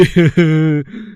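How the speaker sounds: sneaky giggle behind the fake tears; stifled snicker. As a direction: snicker under the breath. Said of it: "रोने का नाटक करता है।".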